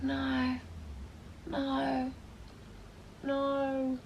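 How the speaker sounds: small, soft, drawn-out refusals; sad disbelief. Retold or imitated. Doorwoman seeing the husband kiss two girls, quietly heartbroken. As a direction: let each word sink, quietly crushed. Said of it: "I was so sad about it".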